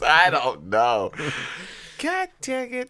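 hammed-up tearful collapse; Forrest Whitaker crying. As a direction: imitate a man bursting into helpless tears. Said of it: "He just cried".

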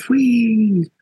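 high, falling imitated whoosh; a long playful "whee", pitched well up; high drawn-out whee. Drummer mimicking tour cash handed over, high playful whee. Mimes money whisked away on tour.

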